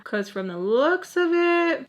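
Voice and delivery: plain reading voice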